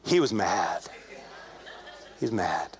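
low, deadpan reveal; ominous understatement. Retold or imitated. Storyteller revealing to the church his friend's anger, low and ominous. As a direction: drop the pitch right down and let it land.

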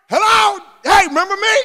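loud, high, insistent hail; acted loud call of a nagging widow. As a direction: pester him for attention at high pitch. Widow pestering the judge, acted.

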